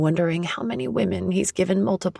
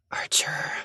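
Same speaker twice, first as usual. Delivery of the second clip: breathy whispered groan of his name; 4 dB quieter than usual. Acted. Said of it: "I groan".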